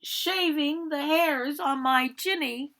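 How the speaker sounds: high, loud yell of a scared pig. Acted. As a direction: shout the wolf away from the door. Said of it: "He yelled back".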